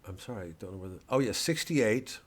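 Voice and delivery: ordinary talk, nothing marked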